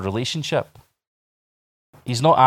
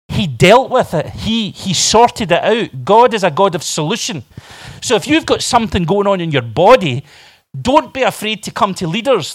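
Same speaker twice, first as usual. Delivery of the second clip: raised, urgent sermon voice; emphatic preaching; 8 semitones higher, 6 dB louder than usual. Acted. Preacher urging the sick to seek prayer, emphatic. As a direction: exhort the congregation with conviction.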